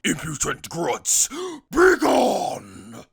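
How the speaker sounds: rising to a high, loud command; Jiren's command. Retold or imitated. Jiren to Seventeen and Frieza, rising command. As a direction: command them away. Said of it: "Jiren basically goes like".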